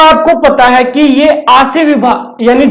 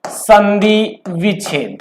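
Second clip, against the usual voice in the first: slow and softer, drawn out as if writing it on the board; slow dictation; 3 semitones lower, 7 dB quieter, slower than usual. Shown as a demonstration. Teacher to students while writing on the board, slow soft dictation. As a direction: spell out संधि विच्छेद slowly and quietly, each part stretched.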